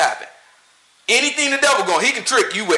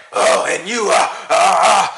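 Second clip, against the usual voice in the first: mock preacher. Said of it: "They say".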